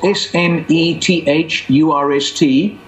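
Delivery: slow letter-by-letter spelling. Shown as a demonstration. Spelling his name slowly for the listeners.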